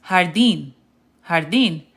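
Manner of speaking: "jardín" said har-DEEN, stressed at the end; Spanish "jardín" opening on an H sound. Shown as a demonstration. Teacher models the word for garden, "jardín" with H sound. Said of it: "J's are pronounced like H's".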